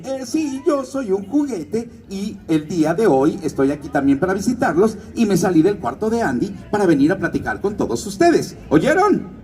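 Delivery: vaquero de juguete animado, tono más agudo; Woody. Shown as a demonstration. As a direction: habla como un juguete vaquero que saluda al público. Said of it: "que se llama Woody". (voz de Woody)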